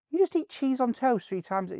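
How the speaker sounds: very high-pitched disbelief; retold incredulity. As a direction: query it in squeaky mock astonishment. Retold disbelief at actor's diet.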